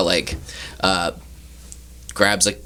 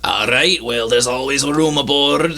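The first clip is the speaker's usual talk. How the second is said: higher-pitched sea captain voice; welcoming ship captain; 6 semitones higher than usual. Acted. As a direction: welcome a passenger aboard as captain.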